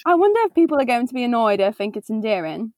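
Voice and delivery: quick put-on British accent. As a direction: wonder aloud as a mock Brit. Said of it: "are we doing a British accent".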